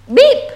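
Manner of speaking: high, loud imitated horn toot; friendly truck beep. A narrator's reading. Friendly truck greeting animals, high beep. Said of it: "said the friendly little blue truck".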